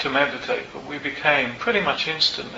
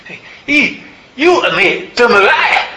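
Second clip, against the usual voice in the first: high, loud, badly faked Glaswegian; hammed-up Glasgow woman, pitched way up; a put-on Scottish lilt, openly bad; terrible Glasgow accent; 12 semitones higher, 13 dB louder, slower than usual. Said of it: "in an accent which I'll do, but it's terrible".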